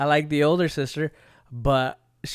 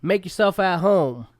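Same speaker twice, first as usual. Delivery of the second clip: open and friendly; 7 semitones higher, 6 dB louder, slower than usual. Acted. Host welcoming viewer in.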